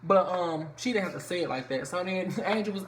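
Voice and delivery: normal talking voice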